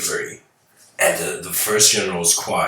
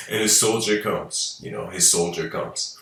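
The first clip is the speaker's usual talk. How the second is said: normal talking voice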